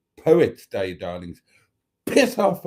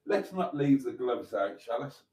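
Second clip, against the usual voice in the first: softer, quaint put-on voice; odd put-on voice; 8 dB quieter than usual. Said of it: "God, I'm sounding like mustard there".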